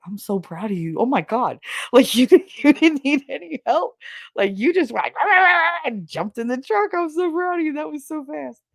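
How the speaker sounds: high, squealing gush of praise; gushing brother impression. Brother gushing that she jumped into the truck, squealing high. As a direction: gush over her like an over-excited big brother.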